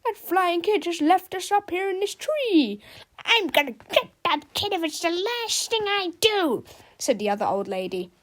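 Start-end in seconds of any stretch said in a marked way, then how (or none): 0.0-2.8 put-on indignant old lady. A narrator's reading. 3.2-6.6 put-on vengeful old lady. A narrator's reading. Old lady vowing revenge.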